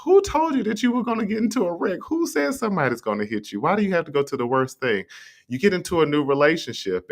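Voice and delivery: high, incredulous who-told-you; incredulous challenge. Acted. To the worrier who fears a wreck, high incredulous challenge.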